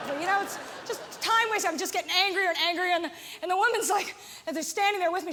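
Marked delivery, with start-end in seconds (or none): none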